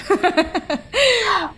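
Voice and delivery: a sudden bright high-pitched laugh. Real, not acted. Host laughs at coffee remark.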